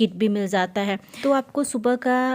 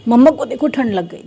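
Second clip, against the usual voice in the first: louder, calling across the room; calling to son; 3 semitones higher, 8 dB louder than usual. Real, not acted. (बेटे को ज़ोर से पुकारती माँ)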